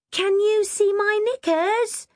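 high, slow, anxious call. A narrator's reading. Kim up the ladder in a skirt, calling anxiously. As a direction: call down worriedly. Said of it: "she called anxiously".

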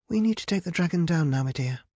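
mild scolding, starting high and falling. A narrator's reading. Rapunzel wants the dragon landed, mildly scolding. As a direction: firmly tell off a younger sister. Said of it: "scolded her sister".

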